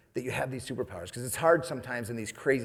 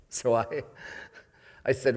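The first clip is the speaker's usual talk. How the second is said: usual speaking voice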